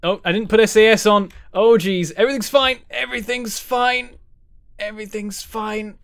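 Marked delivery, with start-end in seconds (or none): 2.1-4.1 high-pitched, rising alarm; high, strained denial mid-crisis; panicked self-reassurance. Real, not acted. Craft failing, he insists otherwise. 4.8-6.0 softer, still raised in pitch; cling to hope under your breath; high but softened, uneasy denial; faltering self-reassurance. Real, not acted. Same words as craft keeps failing.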